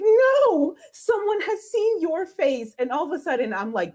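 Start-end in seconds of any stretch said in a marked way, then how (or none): 0.0-2.8 high, drawn-out mock wail; retold tearful dismay. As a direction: wail it in dismay, much higher and slower. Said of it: "my tears turn from happy to sad".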